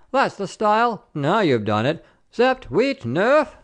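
high, rowdy teasing then counting; jeering card player. A narrator's reading. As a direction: crow over the broken bottle. Card players jeer, count cards.

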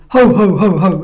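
loud booming ho ho ho; cure-the-hiccups pirate roar. Acted. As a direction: bellow it like a pirate. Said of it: "you go".

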